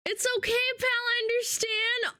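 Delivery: high, cooing sympathy; high-pitched pitying voice; mock consoling. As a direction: coo it like comforting a sad pet. Consoling the sorry viewer.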